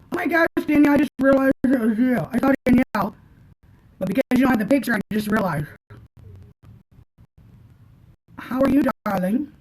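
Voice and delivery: high, loud delighted greeting to a viewer; suddenly recognizing a friend in the chat, thrilled; excited surprise. Real, not acted. Spots viewer Danielle in chat.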